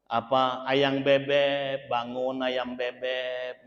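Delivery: slow, soft, low lovey-dovey coaxing; sweet and gentle. Retold or imitated. Wife sweetly coaxing husband awake, slow and soft. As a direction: coo at him slowly. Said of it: "Dengan lemah lembut".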